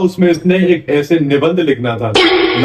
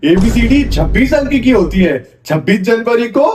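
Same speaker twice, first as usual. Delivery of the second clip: mounting exasperation; 4 semitones higher than usual. Acted. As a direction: read out, climbing steadily to a high outburst. Teacher reads essay with mounting exasperation. (झल्लाहट में पढ़ते हुए आवाज़ लगातार ऊँची)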